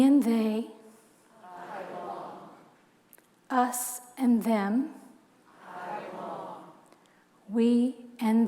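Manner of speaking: slow, measured reading with long pauses; solemn poem read to the congregation; poem recital. Acted. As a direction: let each phrase land like a prayer. Reads the poem aloud.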